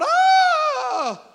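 long, very high, loud scream; piercing drawn-out "Ah!" of terror; terrified scream. Acted. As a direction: cry out at full volume as if seeing a ghost. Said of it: "they scream, they cry out".

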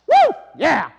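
loud crowd whoop; whooping like a thrilled crowd; excited cheer. Retold or imitated. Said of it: "Everybody was really excited".

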